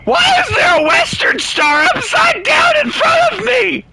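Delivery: very high shocked shriek; real scream. Real, not acted. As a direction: scream the question in disbelief. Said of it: "The scream that came from me when I saw that".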